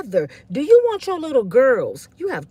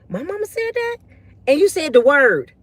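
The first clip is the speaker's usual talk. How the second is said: imitating embarrassed child; 4 semitones higher, 3 dB louder than usual. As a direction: pitch it up like a disbelieving child. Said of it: "they in school and they be like".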